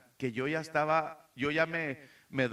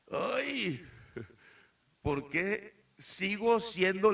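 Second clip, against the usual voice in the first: high, lightly mocking moaning voice; higher, slower mock lament; frustrated self-lament; 6 semitones higher, slower than usual. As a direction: imitate someone fed up with themselves. Voicing a frustrated inner lament.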